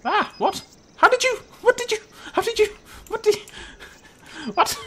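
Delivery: very high, loud, stammered disbelief; shocked squawking. Real, not acted. Player catching the dodos misbehaving, shocked squealing. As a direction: squeal in baffled shock, breaking off each question.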